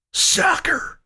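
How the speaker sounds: outraged, as if it's a curse; outraged cry. Acted. McCreary seeing his football field ruined, outraged. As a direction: spit the word out in high disgust.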